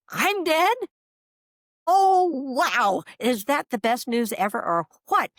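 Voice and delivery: high, loud, thrilled realization; acting out giddy astonishment, pitch soaring; retold amazed delight. Woman reliving the news that she's dead, amazed delight.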